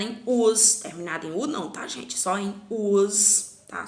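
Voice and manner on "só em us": Só em us said slowly and loudly, dwelling on us; slow, louder teaching emphasis on us; emphatic us. Shown as a demonstration. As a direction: insist on the us ending. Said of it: "Só no plural mesmo".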